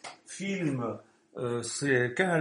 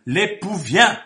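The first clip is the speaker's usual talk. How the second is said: loud proclamation; 5 semitones higher, 10 dB louder, slower than usual. Acted. Preacher crying out the herald's call to the sleepers, loud and high.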